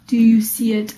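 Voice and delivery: ordinary delivery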